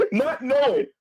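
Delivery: laughing through the words. Real, not acted. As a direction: chuckle it out, slowing down. Laughs while retelling.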